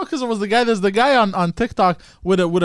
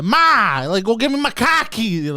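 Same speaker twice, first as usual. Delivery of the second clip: higher, laughing Boston yell for Ma; Boston accent; 4 semitones higher, slower than usual. Shown as a demonstration. Showing friends a son calling Ma, Boston accent. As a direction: call to Ma in hammed-up Boston. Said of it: "say words with the accent".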